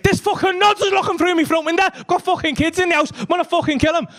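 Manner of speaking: high-pitched angry rant; worked-up retold fury; fuming. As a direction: rant in outrage, pitched right up. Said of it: "Machete's fuming".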